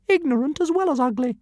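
fuming tiny dragon. Acted. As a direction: huff it out, deeply insulted. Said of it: "fumed Zigarastika".

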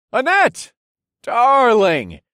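loud, high calling out; hollering. Acted. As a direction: holler across the crowd. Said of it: "I hollered".

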